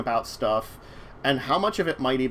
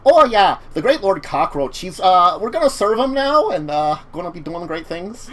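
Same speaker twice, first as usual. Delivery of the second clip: high, loud Midwestern cockroach voice; eager Midwestern cockroach minion; Midwestern accent; 4 semitones higher, 6 dB louder than usual. Pretend. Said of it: "sound him a little Midwest".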